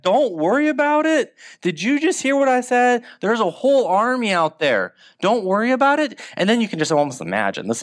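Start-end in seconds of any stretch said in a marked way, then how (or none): 0.0-6.2 much higher, incredulous mock protest; indignant disbelief. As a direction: throw his calm words back at him in disbelief. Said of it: "I would struggle with that".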